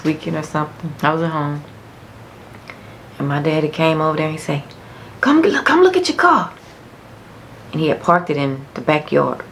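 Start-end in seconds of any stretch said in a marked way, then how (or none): 5.2-6.5 high, louder excited dad; proud dad. Retold or imitated. Dad calling daughter to see her new car, high and excited. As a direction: call her out eagerly.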